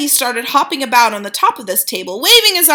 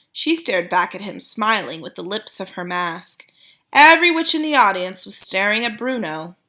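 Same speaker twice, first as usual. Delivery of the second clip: usual speaking voice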